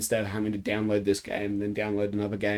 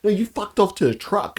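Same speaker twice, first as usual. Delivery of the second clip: high, indignant retelling; mock outrage; 9 semitones higher, 3 dB louder than usual. Player accusing teammate of fleeing to the truck, mock outrage. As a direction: accuse them, playfully outraged. Re-enacts scolding the teammate who left.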